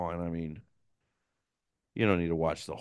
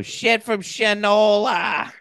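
high, loud, drawn-out holler; Bill Burr; 13 semitones higher, 6 dB louder, much slower than usual. Retold or imitated. Quoting a comedian's podcast saying, a high drawn-out holler. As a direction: stretch it out in a strained high yell. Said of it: "I've heard Bill Burr say it".

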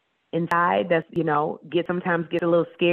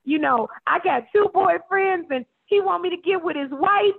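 much higher mock girlish caller voice; imitating advice caller; 12 semitones higher than usual. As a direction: play the confused caller, hammed up. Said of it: "the girl was like".

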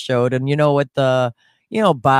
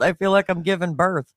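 ordinary delivery

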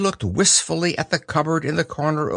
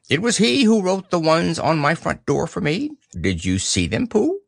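high owl voice, rising question; Owl, self-important; 5 semitones higher than usual. Acted. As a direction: explain proudly as an owl.

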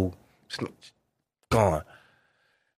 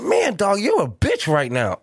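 mock insult; 10 semitones higher than usual. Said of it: "He literally said".

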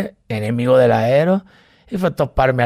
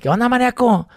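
brash greeting; 9 semitones higher than usual. Retold or imitated. Greeting in Monterrey.

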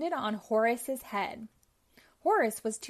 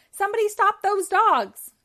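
high, much louder furious shriek; villain's cry; 9 semitones higher, 10 dB louder than usual. Acted. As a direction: scream for someone to stop them. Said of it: "she cried".

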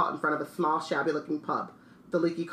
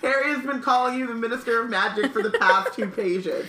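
higher, amused complaint; mock exasperation; 6 semitones higher, 4 dB louder than usual. Reader heckles the Minister's repeated title, mock exasperation. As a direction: protest as if fed up, playfully.

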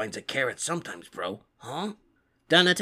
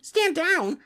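much higher, louder command; urgent shout; 4 dB louder than usual. Acted. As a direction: shout it now, losing patience. Order repeated, more urgent.

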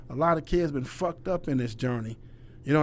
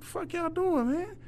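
higher, incredulous mock anger; indignant outburst; 13 semitones higher, 7 dB quieter, slower than usual. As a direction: call out the traitors in disbelief. Confronting players who defected.

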